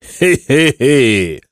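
high, strange horse-like hy hy hy; whinny the laugh like a wild horse; wild neighing laugh. Acted. Monster neighs a wild laugh at the captured boys. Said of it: "zarżało dzikim głosem monstrum".

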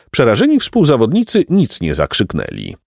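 plain reading voice

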